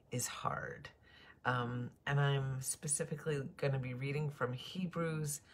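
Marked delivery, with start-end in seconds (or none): 0.1-0.9 hushed, breathy half of the title; whispered. Shown as a demonstration. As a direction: drop to a whisper on is Hard.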